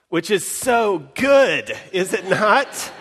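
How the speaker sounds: thrilled exclamation with a laugh; delighted. Real, not acted. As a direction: savour how good it is, laughing. Delighted at the line.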